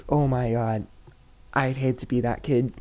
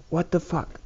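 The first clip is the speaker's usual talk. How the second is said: inner disbelief; 5 semitones higher than usual. Retold or imitated. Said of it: "I'm not really saying that".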